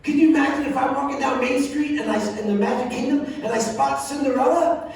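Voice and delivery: ordinary talk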